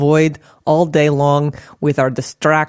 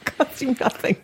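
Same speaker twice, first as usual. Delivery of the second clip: laughing; 9 semitones higher, 7 dB quieter than usual. Real, not acted. Laughing echo of co-host.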